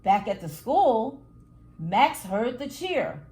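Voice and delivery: ordinary delivery, nothing marked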